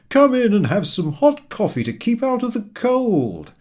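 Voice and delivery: loud, high, friendly call; cheery shout. A narrator's reading. Papa Panov inviting the sweeper for coffee, cheery shout. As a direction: call out warmly over the street. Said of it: "he shouted across the street cheerily".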